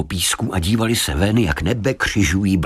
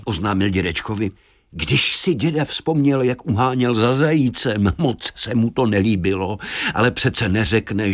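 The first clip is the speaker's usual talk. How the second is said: usual speaking voice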